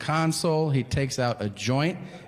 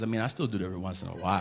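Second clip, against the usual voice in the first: sheepish driver; 7 semitones lower, 5 dB quieter, much faster than usual. Retold or imitated. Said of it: "he goes".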